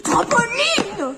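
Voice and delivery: slow, drawn-out high whine; whiny kid. Acted. Nathan whining at his mother for the trampoline, slow and high. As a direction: stretch the word like a sulking child.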